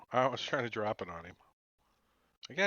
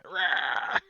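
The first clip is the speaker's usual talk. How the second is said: vampire head roar; 5 semitones higher, 6 dB louder than usual. Pretend. Vampire head token shown, roaring.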